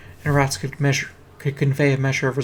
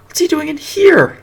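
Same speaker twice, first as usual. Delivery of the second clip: higher, louder, slowed alarmed question; 14 semitones higher, 11 dB louder than usual. Acted. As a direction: call out in alarm. Girl alarmed about the rat.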